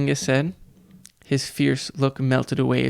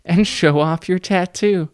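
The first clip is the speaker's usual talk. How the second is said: louder fake playful threat; mock joking; 5 semitones higher, 4 dB louder than usual. A narrator's reading. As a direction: pass the threat off as a joke. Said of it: "as if he was still joking".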